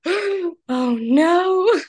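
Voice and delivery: high, dismayed little cry; the dread of being found out. Retold or imitated. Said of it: "I was just like".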